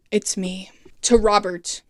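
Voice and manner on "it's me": whispered confession. Retold or imitated. Malcolm's confession, retold.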